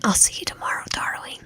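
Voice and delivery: soft breathy whisper in the ear; seductive whisper. Acted. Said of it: "seductive voice whisper in my ear".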